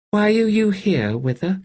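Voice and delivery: put-on high, wondering girl's voice; a small girl in awe of the ghost; child Kettle. A narrator's reading. Kettle to the wraith, high and wondering.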